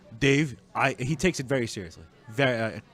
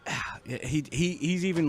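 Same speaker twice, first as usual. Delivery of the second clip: usual speaking voice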